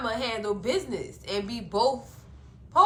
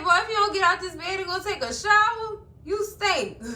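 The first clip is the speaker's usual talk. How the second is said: raised, higher-pitched mock telling-off; retold scolding; 7 semitones higher, 4 dB louder than usual. Fatima ordering Boy out of bed, retold scolding. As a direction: tell him off, pitched up.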